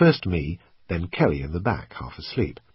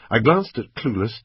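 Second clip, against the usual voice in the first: ordinary delivery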